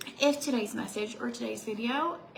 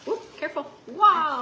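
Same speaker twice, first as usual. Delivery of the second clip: loud high startled wow; 6 semitones higher, 13 dB louder than usual. Real, not acted. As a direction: react to a near spill. Near spill.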